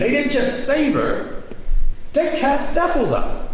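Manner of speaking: high, slow, amazed; awed emphasis. Real, not acted. Telling how devils were cast out of mother, high and awed. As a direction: let the wonder climb.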